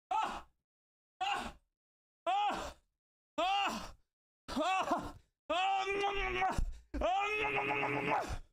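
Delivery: high, repeated yelps of joy; overjoyed cries. Real, not acted. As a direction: let out burst after burst of high cries.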